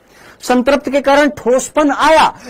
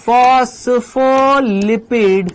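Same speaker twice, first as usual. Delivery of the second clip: Phospholipid drawn out syllable by syllable at half speed; slow dictation; much slower than usual. Real, not acted. Teacher writing phospholipid out again, very slow dictation. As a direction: stretch out every syllable of phospholipid. मैं वापस से लिख देता हूं.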